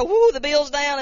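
loud joyful whoop; loud happy whoop; whooping cheer. Retold or imitated. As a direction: cheer it out, thrilled about the bill. Cheering about lower phone bill.

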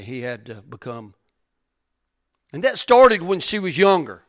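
loud, high impassioned preaching; preaching at full force. Real, not acted. Pastor telling the church of his daughter, loud and fervent. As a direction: drive it home with fervour.

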